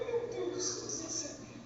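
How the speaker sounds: high, soft awed murmur; a hint of awed relief; hushed amazement. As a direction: breathe it out in wonder. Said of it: "Aí eu falei".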